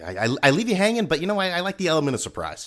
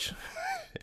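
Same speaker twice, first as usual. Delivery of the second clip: high wheezy laugh at own joke; corny dad laugh; 16 dB quieter than usual. Real, not acted. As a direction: snicker at your own punchline. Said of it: "I'm laughing at my own jokes".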